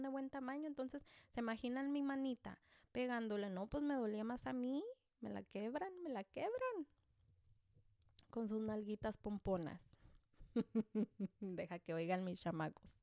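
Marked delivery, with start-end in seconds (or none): 6.0-6.9 much higher, almost squeaky repeat; exaggerated high whine about a sore hand; mock pained squeal. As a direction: jump way up in pitch on the repeat, like it really hurts. Hand hurt from spanking kids.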